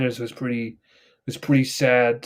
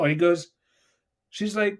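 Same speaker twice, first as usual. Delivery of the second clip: normal talking voice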